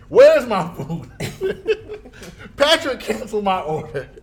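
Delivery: loud high laughing complaint; Karen voice. Retold or imitated. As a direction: demand it like an entitled customer. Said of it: "I turn into Karen".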